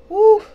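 high, loud joyful woo; excited whoop. Real, not acted. Preacher thrilled by the Bible note, loud high whoop. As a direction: let out a delighted whoop.

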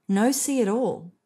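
ordinary delivery, nothing marked

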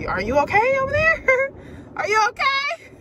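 high, squeaky mock-worried "are you okay"; mock concern. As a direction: fake pity, teasing the offended ones. Mocking people who get offended.

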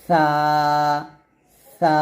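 সা with a light th-like s, tongue at upper teeth; thin, soft letter. Shown as a demonstration. Teacher repeats সা for pupils, thin and light. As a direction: keep সা thin and soft, vowel held. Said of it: "এটা পাতলা হবে".

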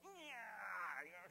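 long, falling pained whine; mock pinched yelp. Child pinched by parents in the pew, mock drawn-out yelp. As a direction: let out a stretched yelp as if pinched.

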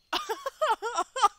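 big loud laugh bursting out; loud burst of laughter. Real, not acted. Gamer laughing at a kid flung off-screen, a loud laugh.

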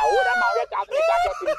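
fast, high laughing yell; laughing shout. Real, not acted. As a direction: rattle it off fast while laughing. Laughing rant in Yoruba.

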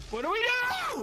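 frantic high question; panicked. Real, not acted. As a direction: voice shooting up high. Panic over screaming victims.

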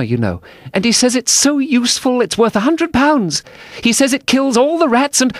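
high-pitched, gushing chatter; eager. A narrator's reading. Alice tells the mouse about a dog, high eager voice. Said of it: "so Alice went on eagerly".

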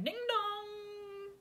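sung doorbell chime, high; doorbell imitation. Telling a friend the doorbell rang, chiming doorbell imitation. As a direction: mimic the doorbell, two high notes.

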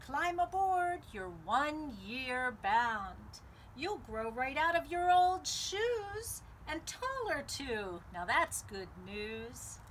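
ordinary delivery, nothing marked

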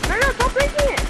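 high, loud panicked yell; panicked shout. Real, not acted. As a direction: cry out to stop them. Panicked callout in game.

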